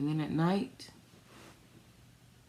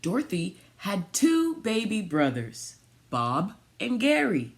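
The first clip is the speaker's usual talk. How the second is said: storybook reading; 4 semitones higher, 5 dB louder than usual. Acted. Reads picture book aloud.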